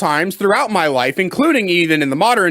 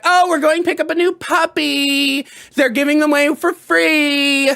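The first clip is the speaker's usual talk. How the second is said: higher put-on mom voice, breezy and cheerful; mocking mom; 6 semitones higher than usual. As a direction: play his mother casually announcing a free puppy. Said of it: "She'd be like".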